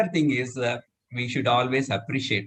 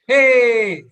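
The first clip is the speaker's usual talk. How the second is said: loud high cheer; joyful cheer for the group; cheering hey; 11 semitones higher, 10 dB louder than usual. Shown as a demonstration. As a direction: shout hey like an excited child. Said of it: "but we will say".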